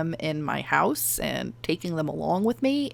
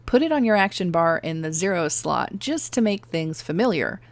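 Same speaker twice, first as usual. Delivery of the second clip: ordinary talk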